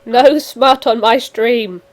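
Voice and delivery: loud, emphatic house rule; mock-stern proclamation. Streamer laying down stream rules to friends, mock-stern and loud. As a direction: lay down the law loudly, then amend it.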